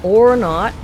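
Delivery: loud startled outburst; sudden shout. Real, not acted. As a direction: yell it out suddenly. Sudden surprise in game.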